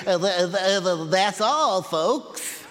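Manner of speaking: stuttering cartoon pig sign-off; Porky Pig. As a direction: stammer through it like a cartoon pig. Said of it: "said this in his best Porky Pig voice".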